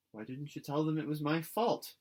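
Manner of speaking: insistent question; demanding. A narrator's reading. Anne demanding Diana explain, insistent. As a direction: press her for an answer. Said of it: "demanded Anne".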